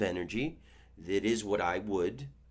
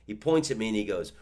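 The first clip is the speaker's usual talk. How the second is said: ordinary talk, nothing marked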